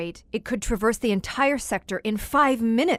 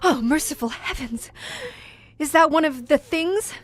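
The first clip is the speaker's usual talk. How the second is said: higher and louder, aghast; horrified alarm; 5 semitones higher, 6 dB louder than usual. Acted. Visitor hearing the monsters on the speaker, loud horrified alarm. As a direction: recoil from what you hear. Hears the creatures over the microphone.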